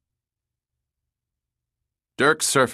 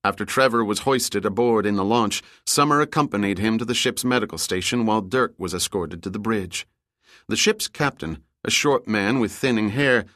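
ordinary delivery, nothing marked

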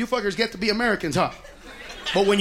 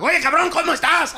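higher Mexican Spanish greeting; 6 semitones higher, 3 dB louder than usual. Retold or imitated. Mexicans in Mexico greeting him, higher Mexican Spanish. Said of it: "they're like".

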